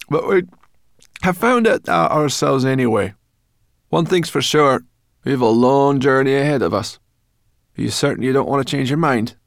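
high, loud character voice, insistent; Sorrel, brash companion. A narrator's reading. Sorrel questioning the dragon about the journey, high loud role voice. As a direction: speak up high and loud, pressing the point.

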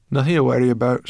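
cheeky little forest creature; brownie voice. A narrator's reading. Sorrel voiced by narrator.

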